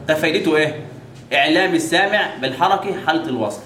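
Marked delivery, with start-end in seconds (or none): none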